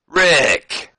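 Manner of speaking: loud, high call across the room; voiced wrestler calling a friend. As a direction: hail him from the doorway. Benoit calling out to Flair.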